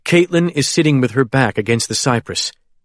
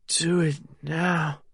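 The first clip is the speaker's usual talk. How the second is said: soft, slow, slurred and a little higher; slurred echo; 4 semitones higher, 10 dB quieter, slower than usual. A narrator's reading. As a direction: echo it weakly, words dragging. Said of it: "her voice slurred".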